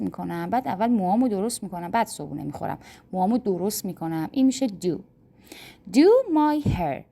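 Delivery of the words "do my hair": slow model phrase. Shown as a demonstration. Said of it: "model phrase".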